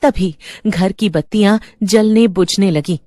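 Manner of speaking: ordinary delivery, nothing marked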